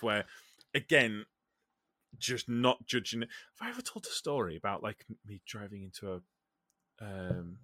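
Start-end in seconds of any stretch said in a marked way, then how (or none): 3.6-7.6 whispering. Real, not acted. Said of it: "Why are you whispering?".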